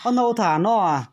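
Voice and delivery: loud and high, calling to a deaf elder; to someone hard of hearing. Real, not acted. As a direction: raise your voice so grandma hears. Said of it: "phải nói to to bà cụ mới nghe được".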